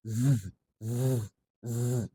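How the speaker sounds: Z as a buzzing sound, not the letter name; isolated voiced Z buzzes, softly; thicker s. Shown as a demonstration. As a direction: hold each Z as a short buzz. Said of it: "Next, lebih tebal".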